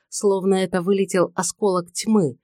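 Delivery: plain reading voice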